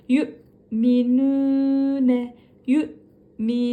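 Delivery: yu'minune slow, yü sharply bounced; slow recitation. Shown as a demonstration. Teacher reciting yu'minune slowly. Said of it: "diye uzatıyoruz".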